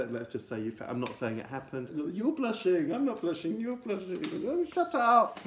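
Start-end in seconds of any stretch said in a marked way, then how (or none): 2.1-4.2 high, mock childish teasing; high sing-song playground tease; teasing kids. 4.5-5.4 high mock squeal; high flustered "shut up"; embarrassed retort.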